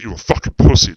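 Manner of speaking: retold yell at a teammate; mock angry insult. Made man berating Rondo, retold as a loud insult. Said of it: "he was like".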